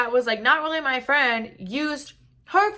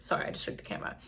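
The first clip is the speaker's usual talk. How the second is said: breathy, much softer throwaway apology; whispered aside; 12 dB quieter, much faster than usual. Real, not acted. The vlogger apologising to viewers for the camera, whispered. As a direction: drop to a whisper for a quick aside.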